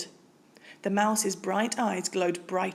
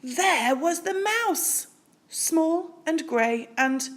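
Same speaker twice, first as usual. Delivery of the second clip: slow, higher dramatic reveal; surprise reveal; 8 semitones higher, slower than usual. A narrator's reading. Narrator reveals the mouse again, slow and higher. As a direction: draw out the surprise.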